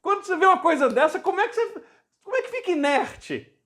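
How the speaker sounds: high-pitched, impassioned exclamations; fervent amazement. Real, not acted. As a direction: exclaim it high, carried away by the miracle. Fervent reaction to a retold miracle. (exclamação fervorosa, voz bem aguda)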